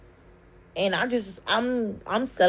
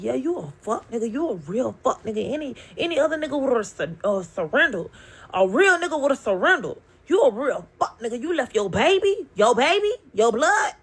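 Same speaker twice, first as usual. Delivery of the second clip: retold angry yelling; 5 semitones higher, 5 dB louder than usual. Retold screaming match in car.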